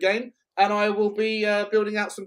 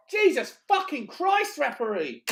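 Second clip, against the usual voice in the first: exasperated; 8 semitones higher, slower than usual. Real, not acted. Exasperated at referee.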